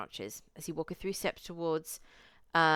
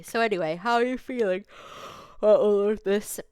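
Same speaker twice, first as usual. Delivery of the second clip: Marcus asking; 5 semitones higher, 9 dB louder than usual. Acted. Marcus voiced, asks player.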